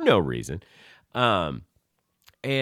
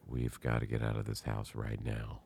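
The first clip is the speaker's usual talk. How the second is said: soft, hushed urgent warning; whisper-soft, keep it urgent; hushed and urgent, afraid of being heard; 13 semitones lower, 12 dB quieter than usual. Retold or imitated. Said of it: "like, in her voice".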